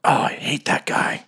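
whispered grumble to an ally; hushed, conspiratorial aside; secretive whisper. Acted. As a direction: grumble it under your breath. Secret exchange with captain.

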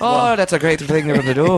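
put-on high, quick leprechaun Irish accent. Mugging victim taunting the mugger, leprechaun Irish. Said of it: "go for the leprechaun Irish".

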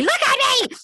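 mock insistent plea. Retold plea, escalated repeat.

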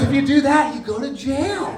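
high and loud, laughing through the accountant's warning; laughing quote. Retold or imitated. Speaker retelling accountant's jail warning, laughing. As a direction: blurt the punchline while cracking up.

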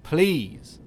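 high loud desperate plea; pleading shout. A narrator's reading. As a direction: beg her loudly. Begging wife to stop.

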